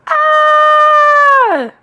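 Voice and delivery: very high, loud held scream; piercingly high yell of alarm; startled yell. A narrator's reading. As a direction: scream long in sheer fright. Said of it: "His father yelled".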